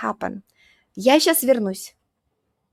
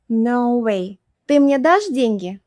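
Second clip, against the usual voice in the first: ordinary talk, nothing marked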